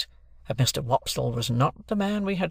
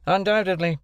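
higher, quicker, pointed repeat; mocking echo; 6 semitones higher than usual. A narrator's reading. Stranger repeats Wopsle's answer, bullying. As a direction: throw the word straight back.

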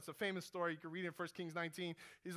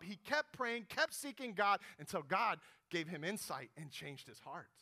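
normal talking voice

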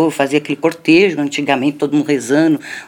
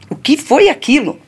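higher, louder scolding aunt; aunt demands what that was; angry scolding; 8 semitones higher, 4 dB louder than usual. Retold or imitated. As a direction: confront the child about her behaviour. Aunt scolding child at home.

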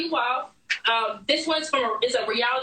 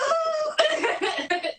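real laughter. Real, not acted. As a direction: burst out in a high, whooping laugh. Laughing before the last question.

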